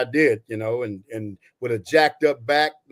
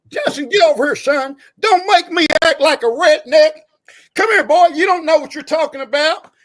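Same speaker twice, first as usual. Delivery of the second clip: put-on East Tennessee accent; 13 semitones higher, 8 dB louder than usual. Sergeant calling a young soldier over, loud high East Tennessee accent. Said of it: "I'm gonna do my, my platoon sergeant's accent".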